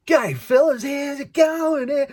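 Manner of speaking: put-on broad Ocker Australian accent, high and loud, drawn out; hammed-up Aussie greeting, shouted high then slowed right down; full on Australian. Exchange student greeting wary students in a broad Australian shout. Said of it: "I went full on Australian".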